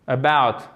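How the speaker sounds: About with a weak schwa, strong BOUT; stress on second syllable. Shown as a demonstration. Teacher models the schwa in About, stress on BOUT. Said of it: "Accentuation sur la deuxième syllabe".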